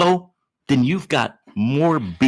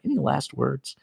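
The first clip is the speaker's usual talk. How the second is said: soft aside; 8 dB quieter than usual. Shown as a demonstration. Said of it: "Does anybody have any last words".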